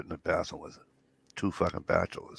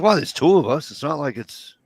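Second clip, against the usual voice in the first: mock-defensive, higher and louder; retold protest; 8 semitones higher, 9 dB louder than usual. Son defends himself to his father over the beer, protesting.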